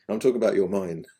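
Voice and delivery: laughing. Real, not acted. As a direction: chuckle it out after the joke. Joke about a puppy peeing.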